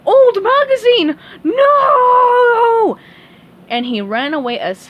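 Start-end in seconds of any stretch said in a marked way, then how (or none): 0.1-3.0 very high, very loud horrified scream. Acted. Patrick sees the old magazine, horrified scream. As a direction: shriek in horror. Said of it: "Patrick screamed".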